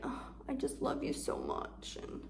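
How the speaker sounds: mock an overly sweet reassurance; mock fake sweetness. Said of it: "don't have to be all fake".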